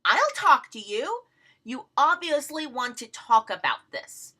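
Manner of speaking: taunting Jeff into an exchange; baiting. Retold or imitated. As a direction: tease him into replying. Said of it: "That's me baiting Jeff, saying".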